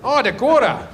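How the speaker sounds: put-on high, playful North Dakotan accent; mock Upper Midwest accent, lifted and teasing; Minnesotan-Dakotan accent. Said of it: "Same accent".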